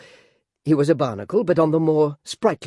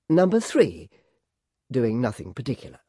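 ordinary delivery

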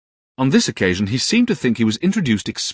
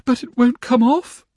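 put-on much higher, straining young wife; distressed Dora; 4 dB louder than usual. A narrator's reading. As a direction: struggle with the shell, near tears. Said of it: "trying very hard and looking very much distressed".

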